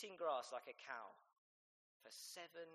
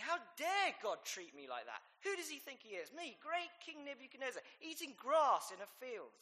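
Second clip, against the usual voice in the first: higher, louder outraged king; indignant rant; 11 semitones higher, 7 dB louder than usual. Pretend. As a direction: rant like an insulted king, building. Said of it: "I, I would be saying".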